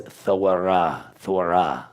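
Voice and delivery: "Thorah" slowly with th and a w glide; proper pronunciation. Shown as a demonstration. Teacher answering how to say it properly, slowly articulated. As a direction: draw out "Thorah" as th-wa-ra. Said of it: "how would you actually say this properly?".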